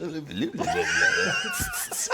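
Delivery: high babbling tongue-wagging noise; imitated demonized gibberish. Snake man walking up to team members, high crazed babble. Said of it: "walk up to the team members and be like".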